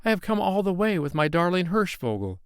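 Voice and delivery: put-on high child's voice, begging; a little boy explaining anxiously; boy pleading with the king. A narrator's reading.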